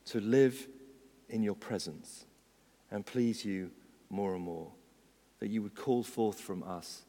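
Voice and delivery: measured prayer with falling phrases and pauses. Acted. Preacher leading congregation in prayer to Jesus, reverently.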